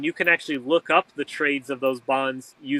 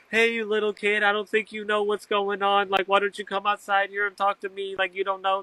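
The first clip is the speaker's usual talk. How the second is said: higher mock voice, talking down to a kid; condescending lawyer; 5 semitones higher than usual. Attorneys mocking the young man beside the bench, condescending. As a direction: sneer at him like a smug attorney.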